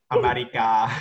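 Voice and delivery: America said the Thai way, ending in ah; playful Thai-accented America. Shown as a demonstration. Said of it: "I use a Thai accent".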